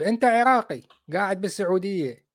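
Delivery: usual speaking voice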